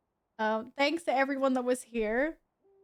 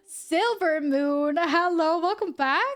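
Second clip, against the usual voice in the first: gasp, then a louder, higher delighted hello; excited greeting; 6 semitones higher, 5 dB louder, slower than usual. Real, not acted. Streamer greeting a long-lost viewer, excited and loud.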